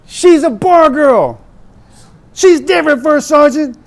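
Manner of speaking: high, loud and slow retort; imitating a yell. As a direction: bark it back at him. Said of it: "the First Sergeant yelled at him back".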